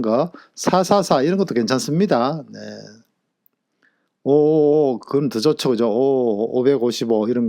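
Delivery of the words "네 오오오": ordinary delivery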